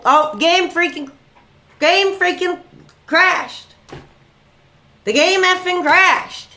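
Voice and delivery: high-pitched, dismayed exclamation; exasperated outcry. Real, not acted. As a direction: cry out as the game dies on you. Game crashes during his live stream.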